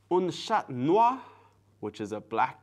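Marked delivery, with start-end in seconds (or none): 0.1-1.2 slow, higher, careful French; French pronunciation. Shown as a demonstration. Learner showing as much French as they know, careful higher-pitched French. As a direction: show off French, lilting upward. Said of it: "if my French was good there".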